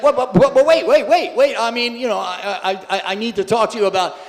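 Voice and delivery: loud, high, stammering mock panic; flustered protest. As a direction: imitate a man frantically trying to stop someone leaving. Man protests as deities are taken.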